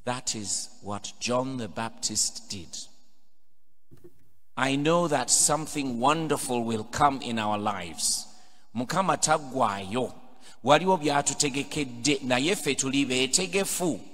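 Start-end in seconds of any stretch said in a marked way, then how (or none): none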